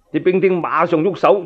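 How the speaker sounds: ordinary delivery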